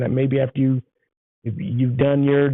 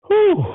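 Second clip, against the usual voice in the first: whew of delight; 5 dB louder than usual. Real, not acted. Remembering the great burrito.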